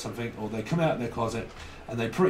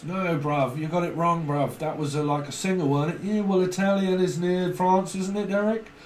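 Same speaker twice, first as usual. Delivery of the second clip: higher-pitched mock argument with a mate; hammed-up banter, climbing higher as it goes; comic bickering bloke; 6 semitones higher, 3 dB louder than usual. Comic bickering with invented Derek.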